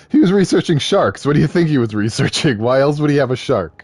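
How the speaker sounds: loud, incredulous, mock-exasperated; exasperated outburst. Real, not acted. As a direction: shout the obvious answer at the screen. Exasperated retort to game character.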